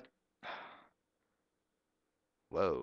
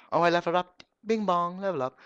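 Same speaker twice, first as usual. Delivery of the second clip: high, loud delighted level-up call; excited cheer; 11 semitones higher, 8 dB louder, much faster than usual. Real, not acted. Gamer celebrating a level-up, high and loud.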